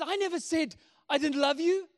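protesting dad. Retold or imitated. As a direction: imitate an exasperated dad defending himself. Dad protests to runaway boy in story.

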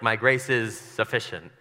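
slow build to "sufficient"; low, slow emphasis on "sufficient"; stress on sufficient. Shown as a demonstration. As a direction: set "sufficient" apart and lean on it.

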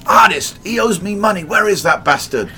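loud, high, furious demand on the phone; angry shouting caller. Retold or imitated. Caller on the phone, angrily shouting for the artist. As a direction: bark it out like a man who's owed money.

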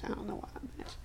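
whispered, half to herself; breathy puzzled whisper; whispered aside. Real, not acted. Friend recapping a show, puzzling over a match, whispered.

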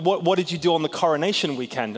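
usual speaking voice

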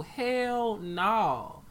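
ordinary delivery

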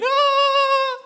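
high, held comic wail of fear; frightened wail. Acted. Boy shaking as dad slams the door, frightened wail. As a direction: let out a long high mock-terrified cry. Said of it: "I was shaking".

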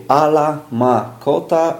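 "Ala ma kota" slowly, each word set apart. Shown as a demonstration. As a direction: read "Ala ma kota" one word at a time, like a schoolchild. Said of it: "słowo po słowie".

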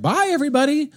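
high singled-out goodbye to the room; imitated breezy exit call; called-out farewell. Wife calls out leaving in commotion.

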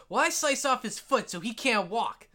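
put-on high-pitched kid announcing a move; eager kid player. Kid declaring an attack on the dragon, eager high voice. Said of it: "the first kid says".

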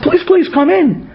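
high, slow please please; pleading welcome. Retold or imitated. Host inviting a weary stranger inside, slow and pleading. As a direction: coax him inside kindly and slowly.

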